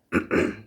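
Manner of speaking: a quick real throat clear. Real, not acted. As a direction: clear the throat before going on. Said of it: "I just had to clear my throat".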